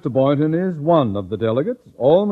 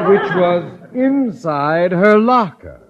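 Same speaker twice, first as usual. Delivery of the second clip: laughs through the words, mocking; laughing; 6 semitones higher, 7 dB louder, slower than usual. Principal gloating to Miss Brooks, laughing. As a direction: cackle at the teacher's trick.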